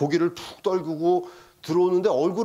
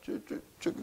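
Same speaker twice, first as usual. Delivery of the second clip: put-on stammering boy; 4 semitones higher than usual. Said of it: "말도 제대로 못해".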